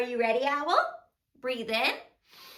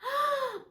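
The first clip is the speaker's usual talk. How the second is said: high, quick gasp; delighted gasp; 12 semitones higher than usual. Pretend. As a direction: gasp at a welcome surprise. Hears who is calling.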